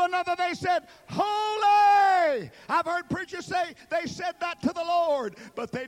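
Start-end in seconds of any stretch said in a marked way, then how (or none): 1.1-2.5 high, loud, drawn-out holy; exalted cry. Acted. Seraphim crying out before the throne, high and loud. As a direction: cry each holy out like an angel.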